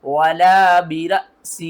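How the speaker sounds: slow chant with a hamza catch; recitation with jerk. Shown as a demonstration. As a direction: recite slowly, break sharply before the last syllable. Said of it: "झटके के साथ". (झटके के साथ धीमी तिलावत)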